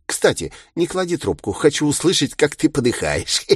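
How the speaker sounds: gloating villain. A narrator's reading. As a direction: savor the threat, then laugh.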